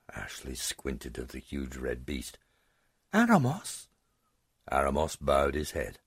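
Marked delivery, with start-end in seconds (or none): 3.1-3.9 put-on high, rising girl's question; astonished girl. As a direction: gasp it out in disbelief. Girl recognizes grandfather.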